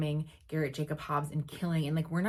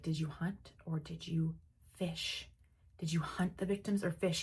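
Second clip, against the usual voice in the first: ominous murmured question; hushed realization; 5 dB quieter than usual. Retold or imitated. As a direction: whisper it as the pieces click. Will realizing, menacing quote.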